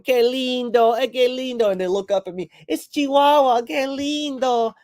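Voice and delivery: high, cooing imitation of the neighbors. Spanish neighbors cooing over the dead dog, high mock coo.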